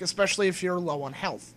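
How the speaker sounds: ordinary talk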